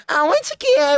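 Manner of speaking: pergunta alta e aguda, aflita. Retold or imitated. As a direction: pergunte onde fica, bem agudo. (pergunta aguda ao moço)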